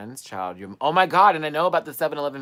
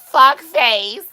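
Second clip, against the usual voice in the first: piercing high mock yell; loud high-pitched shout; 13 semitones higher, 15 dB louder than usual. Answering the driver's challenge, high loud shout. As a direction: blast it back in a high voice. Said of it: "I said it really loud with a little high-pitched voice".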